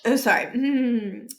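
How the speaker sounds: ordinary delivery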